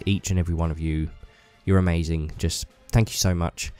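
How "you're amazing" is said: ordinary delivery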